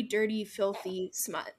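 ordinary delivery

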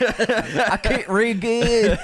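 slow, loud, high laughing Rocky voice. Pretend. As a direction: mumble it like a punch-drunk boxer. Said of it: "Poor Rocky".

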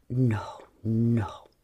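low whispered no. Acted. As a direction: breathe it low. John whispering refusal.

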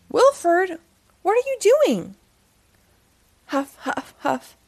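lively read-aloud storytelling voice; story narration. A narrator's reading. Storyteller reading a Halloween book to children.

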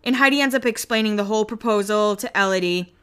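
normal talking voice

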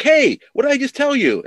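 high, loud mock outrage; exasperated chief. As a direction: scold him like a fed-up police chief. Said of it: "he's like".

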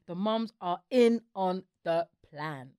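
slow, spaced-out words, firmly landed; deliberate, drawn-out emphasis; slow emphatic repeat. Real, not acted. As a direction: spell it out word by word.

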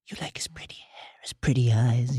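a taunting whisper, voiceless and close; mocking whisper. Orell whispering a jealous taunt to Ygritte.

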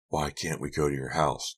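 usual speaking voice

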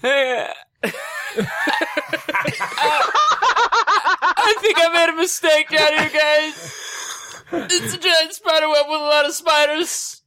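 high, panicked laughing plea; nervous squeaky cry for help. Acted. Character caught in spider web.

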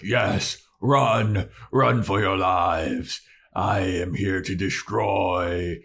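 slow, booming villain troll. Retold or imitated. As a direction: boom like a gloating monster. Troll voiced, retelling scene.

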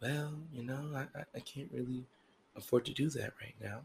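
soft, halting excuse; hushed, hesitant role-play; imagined partner making excuses. Acted. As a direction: stammer it out quietly, reluctant to admit it. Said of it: "And you say".

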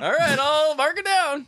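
high, loud mocking squeal; mock clerk voice. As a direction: squeal it back laughing. Mocking clerk reply, laughing.

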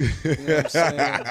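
talking through a laugh. Real, not acted. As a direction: laughs out the punchline. Joke about muscle milk.

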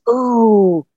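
imitating eager anticipation; excited ooh. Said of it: "you're like".

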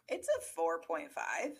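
sighing whisper of a score. Real, not acted. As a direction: breathe it out on a sigh, barely voiced. Scoring the show, sighing.

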